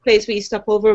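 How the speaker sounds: usual speaking voice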